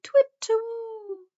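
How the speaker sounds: very high owl call; owl hoot. A narrator's reading. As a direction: hoot it like a wise old owl. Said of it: "he hooted".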